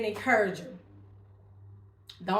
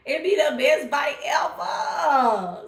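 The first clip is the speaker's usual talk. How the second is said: savoring delight; 4 semitones higher, 7 dB louder, slower than usual. Real, not acted. Savoring a dessert.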